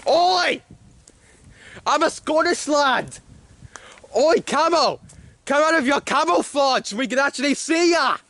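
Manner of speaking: high-pitched Scottish holler; Scottish accent. Pretend. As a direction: call out as an over-the-top Scottish lad. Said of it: "I had a good Scottish accent".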